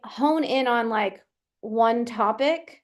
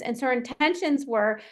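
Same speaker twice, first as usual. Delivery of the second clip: ordinary delivery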